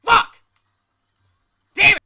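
angry scream; furious scream. Real, not acted. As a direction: yell it in total frustration. Just got killed.

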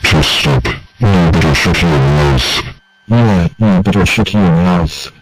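loud, furious warning; angry threats. Acted. Jealous friend threatens the others to shut up, loud and angry.

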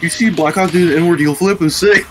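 high, loud laughing hype; excited brag. Real, not acted. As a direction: hype yourself up. Hyping himself up.

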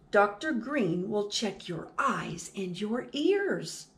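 ordinary delivery, nothing marked

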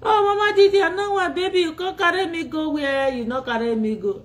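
high, loud whiny mimic of a girl; high-pitched mock pleading. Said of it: "do you know what she said".

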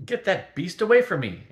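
panicked wizard crying out at the cat; frightened cry. A narrator's reading. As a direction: yell it to get the cat off him. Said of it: "Antorel cried".

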